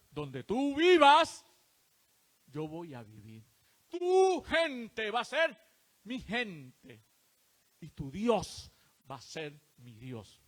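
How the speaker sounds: slow, loud emphasis on each pledge; solemn vow. Acted. Ruth pledging herself to Naomi, slow and solemn. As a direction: pledge it word by word.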